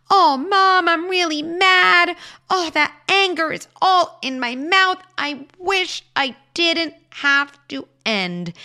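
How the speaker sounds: hammed-up child shouting that he's mad, slowing on the wish; mock angry child. Shown as a demonstration. Parent acting out the child's anger to mom, hammed-up loud and high. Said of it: "I might say".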